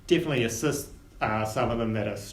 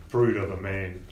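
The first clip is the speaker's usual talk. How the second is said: ordinary delivery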